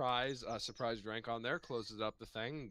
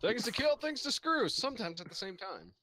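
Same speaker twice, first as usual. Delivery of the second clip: much higher, louder mock repeat of the list; mocking squeak; 12 semitones higher, 4 dB louder than usual. GM to the player, repeating his list in a mocking squeak. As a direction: parrot his words back in a silly squeak.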